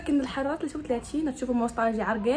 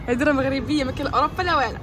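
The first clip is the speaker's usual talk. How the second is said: usual speaking voice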